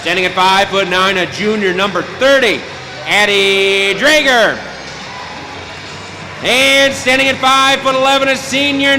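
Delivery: milk each name for the crowd; arena announcer. Acted. PA announcer continues home lineup.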